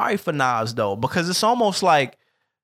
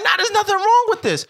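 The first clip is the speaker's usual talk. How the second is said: high disbelieving voice; 4 dB louder than usual. Retold or imitated. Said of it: "I'm like".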